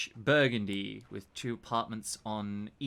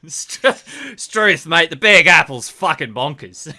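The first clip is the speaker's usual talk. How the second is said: loud, hammed-up Aussie exclamation; mock Australian accent; 4 semitones higher, 16 dB louder than usual. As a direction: play the broad Australian tourist, loudly. Mock Australian in New York.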